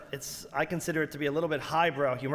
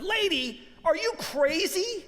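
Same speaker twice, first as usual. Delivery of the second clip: high comic rant at a gloomy shopper; incredulous outburst; 9 semitones higher than usual. Retold or imitated. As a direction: blurt it out like a manic cheerleader. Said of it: "I said".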